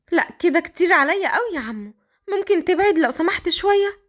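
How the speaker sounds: put-on Qadr, high girlish voice. The girl complaining to Raad his clothes don't fit, high girlish voice. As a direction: speak up high like a shy young girl.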